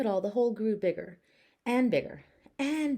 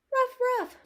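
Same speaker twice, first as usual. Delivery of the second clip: high, louder yappy ruff; little dog barks; 6 dB louder than usual. Acted. Buff answering Mister Pockets with high barks. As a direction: bark up high and bright. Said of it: "Buff said".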